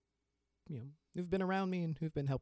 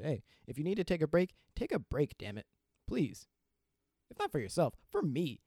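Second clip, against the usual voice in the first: emphatic plea; 6 semitones higher, 5 dB louder than usual. Real, not acted. Urging listeners to rest.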